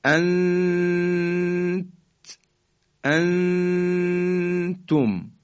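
انتم with the نون hidden in a long nasal hum, very slow; انتم recited slowly with ikhfa; ikhfa demo. Shown as a demonstration. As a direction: recite انت then انتم holding the ghunna.

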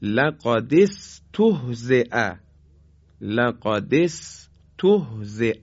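لقد استهزئ read slowly, syllable by syllable; slow recitation. Shown as a demonstration. Teacher reads the verse phrase to students slowly. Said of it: "همزهٔ وصل رو اینجا".

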